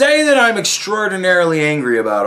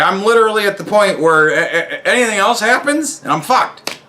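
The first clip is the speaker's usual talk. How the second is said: ordinary talk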